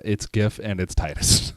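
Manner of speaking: "gif" like gift, "Titus" starting like tie; hard-g "gif", long-i "Titus"; hard g, TY-dus. Shown as a demonstration. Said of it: "say the creator's wrong".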